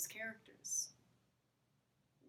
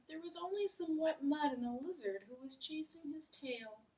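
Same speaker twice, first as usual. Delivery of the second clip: Frog's voice; 6 semitones higher than usual. Acted. Frog's story line.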